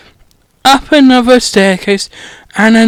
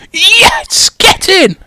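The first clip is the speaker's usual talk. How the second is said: high, excited cheer; 10 semitones higher, much slower than usual. Real, not acted. The reader celebrates Malfoy getting caught, cheering high. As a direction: cheer like a goal went in.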